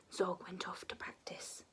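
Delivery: ordinary delivery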